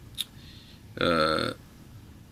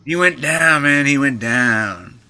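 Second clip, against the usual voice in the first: slow, higher repeat of the line; Johnny's drawn-out voice; 5 semitones higher, 3 dB louder, slower than usual. Retold or imitated. Repeating the fight story, slow and pleased. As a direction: savour it slowly like Johnny. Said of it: "He goes".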